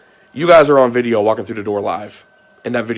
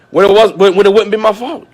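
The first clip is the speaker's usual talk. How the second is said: rising frustration; 8 semitones higher, 7 dB louder than usual. Real, not acted. Heated rant about blame.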